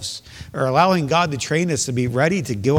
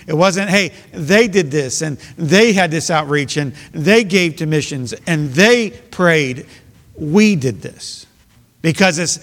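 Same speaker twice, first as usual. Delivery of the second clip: preaching; 4 semitones higher, 4 dB louder than usual. Acted. Preaching builds louder.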